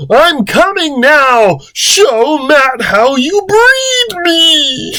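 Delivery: very high, drawn-out mock scream; over-the-top shrieking climax; Chris. A narrator's reading. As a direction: wail it slowly, stretching the last words. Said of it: "Chris yells".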